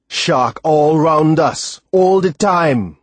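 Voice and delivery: loud, very slow, furious warning; slow roar. Acted. Timothy warns the boy about sharks, slow angry roar. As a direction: hammer each word out in anger. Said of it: "he roared".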